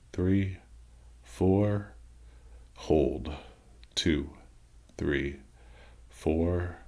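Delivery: slow, measured count with a pause after each word; guided breathing count. Acted. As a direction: pace the breath for them, one word at a time.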